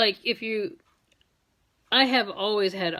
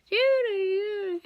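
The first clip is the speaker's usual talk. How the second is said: 1 bird call imitation; 11 semitones higher, slower than usual. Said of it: "the Judy birds".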